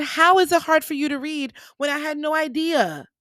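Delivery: can't believe he struggles; incredulous. Retold or imitated. Retelling to trainee, loud surprised disbelief. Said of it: "I said".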